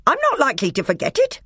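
high loud fast retort; angry dwarf. A narrator's reading. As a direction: snap back in fury. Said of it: "came the angry voice".

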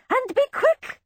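very high screech; piercing high urging. A narrator's reading. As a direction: screech for haste. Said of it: "screeched the other".

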